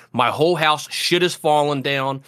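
usual speaking voice

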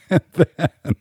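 real high laughing outburst; word dissolves into helpless laughter; breaking into laughter. Real, not acted. Host cracks up over finding his non-privileged category, laughing.

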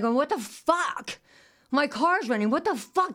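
high, slow, disbelieving what the fuck; stunned, pitch rising in alarm; shocked outburst. Retold or imitated. Panicked car-theft outburst.

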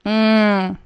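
savoring mm. Real, not acted. Tasting sesame oil, pleased.

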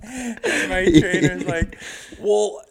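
words squeezed out through high loud laughter; laughing while talking. Real, not acted. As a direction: keep laughing as you tell it. Still laughing while telling.

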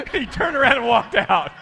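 high, loud, words through laughter; real giggle telling the ending; laughing while talking. Real, not acted. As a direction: laugh the line out.

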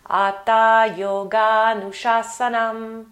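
slow, careful Sanskrit "Atha yoga nushasanam"; Sanskrit recitation. Shown as a demonstration. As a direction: intone "Atha yoga nushasanam" slowly and loudly. Recites the Sanskrit opening line.

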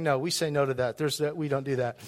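low, subdued imitation of refusers; mock refusal. Pastor voicing church members who won't help, mock-dismissive.

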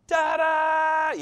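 mock-dramatic shout over a smashed thumb; dramatic yelp. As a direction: play up the hurt thumb with a big theatrical cry. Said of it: "I can be dramatic at times".

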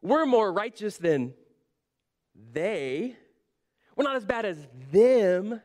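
slow disbelief, long pauses, landing hard on THEM; incredulous. Retold or imitated. Habakkuk protesting to God about the Babylonians, incredulous. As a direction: draw out the comparison in disbelief. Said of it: "He's incredulous".

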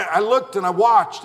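ordinary talk

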